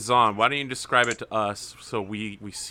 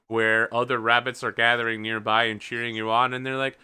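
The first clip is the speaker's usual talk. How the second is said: normal talking voice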